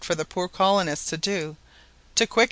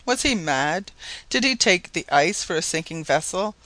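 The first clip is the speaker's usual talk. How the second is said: ordinary delivery, nothing marked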